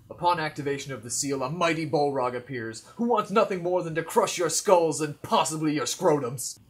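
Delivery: loud, dramatic monster reveal; game master narration. Acted. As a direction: announce the beast to the table.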